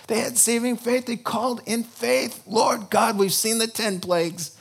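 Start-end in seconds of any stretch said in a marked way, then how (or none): 2.5-4.5 pleading prayer. Acted. Israelites praying for rescue.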